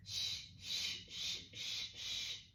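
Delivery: long, steady, repeated "shh"; soothing shush. Shown as a demonstration. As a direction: keep hushing while patting the baby. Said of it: "patting them repeatedly and going".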